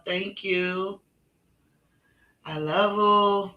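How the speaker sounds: high, drawn-out cutesy thanks; stretched-out lovey-dovey baby talk; sweet pet-talk to Lily. Acted. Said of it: "that's how I say that to Lily".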